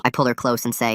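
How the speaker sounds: ordinary delivery, nothing marked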